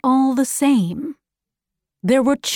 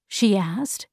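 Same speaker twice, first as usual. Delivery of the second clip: ordinary delivery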